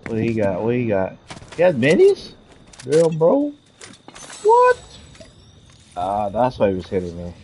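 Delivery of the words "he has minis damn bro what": rising pitch, shocked; shocked disbelief. Real, not acted. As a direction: cry out in disbelief. Shock at enemy's weapons.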